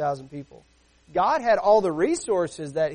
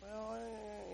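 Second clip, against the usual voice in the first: imitated whine, drawn out and very soft; whiny child; 4 semitones higher, 20 dB quieter than usual. Child answering dad's order with a mock whine. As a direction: mimic a child's reluctant whine. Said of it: "you get the whiny".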